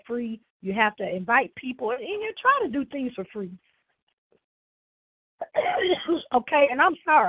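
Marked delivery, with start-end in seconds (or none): 5.4-6.3 hard cough that breaks the speech; cough, then apologize; coughing fit. Real, not acted. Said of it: "I'm coughing so much".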